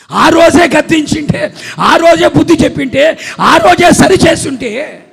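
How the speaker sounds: high, slow, shouted refrain; impassioned rebuke. Real, not acted. Preacher to parents who never corrected, loud drawn-out reproach. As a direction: drive each repeated phrase home at full voice.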